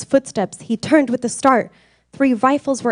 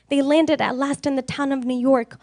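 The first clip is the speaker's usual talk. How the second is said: ordinary delivery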